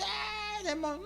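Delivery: high squeaky mock of a preacher yelling at a demon; mocking a screaming preacher. Preacher mocks pastors who scream at demons, high mock yell. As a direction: parody the hollering exorcist, pitched high. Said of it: "eu nunca vi Jesus se esgoelando".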